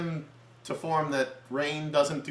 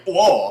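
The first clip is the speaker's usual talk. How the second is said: loud startled mock "oh"; tripped-over yelp; 6 semitones higher, 12 dB louder than usual. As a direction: yelp like someone stumbling on shoelaces. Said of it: "tripped over his own shoelaces".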